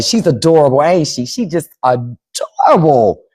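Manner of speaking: slow doting coo; auntie mode. Real, not acted. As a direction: stretch it out, smitten with the little one. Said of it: "I'm gonna be in auntie mode".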